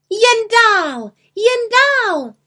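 very high, loud beckoning call; ringing high-pitched witch cry. A narrator's reading. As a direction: summon them from the sky. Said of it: "volala malá čarodějnice".

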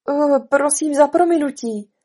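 high, loud, flustered apology; blurted apology. Acted. Alice apologises to toppled jurors, blurting. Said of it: "vyhrkla Alenka".

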